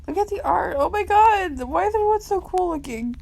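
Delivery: high-pitched, thrilled fangirl wail; excited gushing. Real, not acted. Fan admiring everyone in the artwork, high excited gushing. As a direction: gush over the art, voice shooting way up. Fangirling over the comic art.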